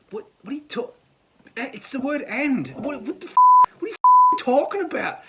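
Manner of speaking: high-pitched, incredulous outburst; mock exasperation. Arguing back over the word "and", exasperated. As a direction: protest in high, sputtering disbelief.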